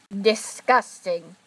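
slow, loud disgusted remark. Acted. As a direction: sneer it out slowly. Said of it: "put in James".

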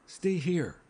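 high-pitched frightened begging; panicky, voice pitched well up; breathed plea. A narrator's reading. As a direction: plead urgently not to be abandoned. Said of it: "she breathed".

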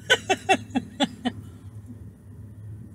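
breathy, stuttering laugh; helpless, airy giggles; giggling. Retold or imitated. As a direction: laugh in a long breathy run. Laughing as the children did.

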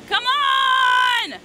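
slow, loud, high come on; drawn-out shout. Real, not acted. Straining to catch the ghost, slow loud shout. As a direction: stretch it out, straining hard.

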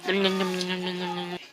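soft lip-smacking munching; quiet playful chomping sounds; eating noises. Pretend. Eating strawberries, mock eating noise. (냠냠 먹는 소리)

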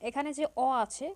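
normal talking voice

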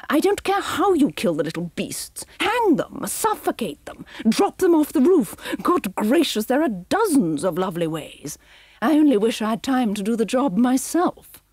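haughty villainess delighting in cruelty; villainess Cruella. Acted. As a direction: relish every cruel word. Cruella voiced by narrator.

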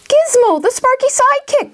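put-on high, loud boyish amazement; excited cry. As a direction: cry out on spotting a hero. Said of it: "cried Mungo".

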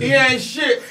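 shouting it through laughter; laughing shout. Real, not acted. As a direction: laugh and yell it out. Group laughing, shouting agreement.

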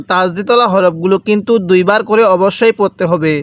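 ordinary talk

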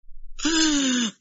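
sharp gasp of joy; emotional gasp. Acted. Afrakoush overcome at the wedding, gasping. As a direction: catch your breath, overcome.

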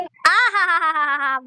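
slow, drawn-out mock "aha-ha-ha"; exaggerated mock admiration; sarcastic awe. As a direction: stretch it out in fake wonder. Sarcastic applause of her tears.

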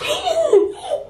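very high, squealed gasp; shocked gasp. Acted. Intruder confronted by the owner's message, shocked gasp. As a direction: let out a high gasp of panic. Message asks why you're inside.

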